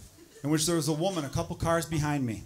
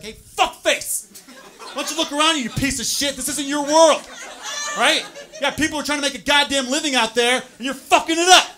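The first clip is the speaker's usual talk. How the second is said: higher, much louder, screaming with rage; furious shout; 9 semitones higher, 10 dB louder than usual. Pretend. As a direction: tear into the cyclist at full volume. Imagined road rage at cyclist.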